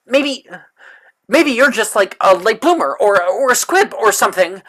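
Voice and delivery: taunting. Acted. Character taunting student.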